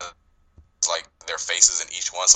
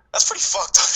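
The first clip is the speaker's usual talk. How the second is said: high, airy exclaim of disbelief; half-laughing mock outrage; 6 dB louder than usual. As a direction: scoff like it's outrageous. Retelling own reaction to scene.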